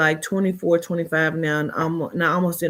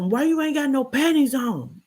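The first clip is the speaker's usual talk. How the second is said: higher, mocking man's voice; imitating him; 8 semitones higher than usual. Retelling him demanding why the girl has no panties, mocking. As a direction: play him demanding, pitched up. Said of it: "He told me".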